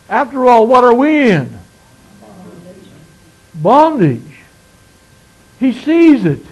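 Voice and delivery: fiery sermon climax, words hammered out; preaching at full force. Acted. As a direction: drive the point home to the congregation.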